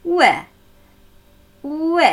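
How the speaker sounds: "Ü" as u gliding into ö; combined U and Ö. Shown as a demonstration. Teacher urging learners to try, u-to-ö glide on "Ü". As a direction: join u and ö in one syllable on "Ü". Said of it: "U mit Ö zu kombinieren".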